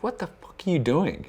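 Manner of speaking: high-pitched imitation of an exasperated friend; mock disbelief, pitched well up; retold exasperation. Friend catches him up all night, retold exasperation.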